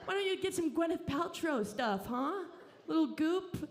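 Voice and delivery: cooing at a baby to go diet, shrill mockery; mocking baby talk. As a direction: coo at the baby like a wellness-obsessed mom.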